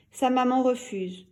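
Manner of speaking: ordinary delivery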